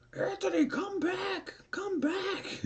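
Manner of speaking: hammed-up falsetto wail, extremely high; mock panicked calling. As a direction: imitate a scared friend crying out after someone. Voices panicked friend calling him back.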